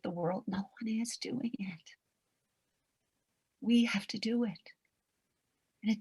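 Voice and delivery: soft, unvoiced heartfelt whisper. Real, not acted. Coach whispering her mission to the listeners. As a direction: hush it like a private confession.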